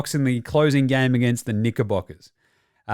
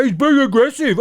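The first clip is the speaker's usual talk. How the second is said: high, mocking imitation; mocking pundit; 9 semitones higher, 4 dB louder than usual. Mocking Chris Paul's punditry.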